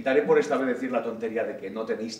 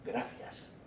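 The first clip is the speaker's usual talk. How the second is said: very soft, almost unvoiced "gracias"; savored word; 4 semitones lower, 16 dB quieter than usual. Speaker admiring the beautiful word gracias, hushed and appreciative. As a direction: let "gracias" out softly, relishing it. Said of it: "Qué hermosa palabra".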